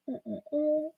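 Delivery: swallowing a drink mid-hum; gulping. Real, not acted. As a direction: hum while gulping down water. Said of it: "Sorry if you can hear me gulping".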